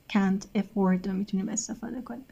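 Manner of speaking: ordinary talk, nothing marked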